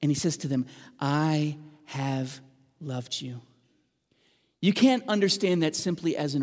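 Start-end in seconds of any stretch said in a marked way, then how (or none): 1.0-3.4 tender. Acted. God's tender declaration to Israel.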